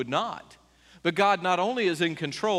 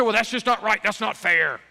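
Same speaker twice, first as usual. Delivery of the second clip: lightly voiced complaining listener; a hint of a protesting skeptic; whiny objector; 4 semitones higher, 4 dB louder than usual. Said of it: "You say".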